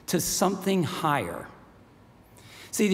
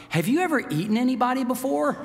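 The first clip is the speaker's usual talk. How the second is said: put-on nervous girl; 7 semitones higher, faster than usual. Frightened girl asks the lion.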